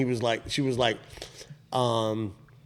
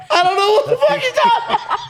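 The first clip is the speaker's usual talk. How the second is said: laughing quote; 4 dB louder, faster than usual. Retold or imitated. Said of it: "and I was like".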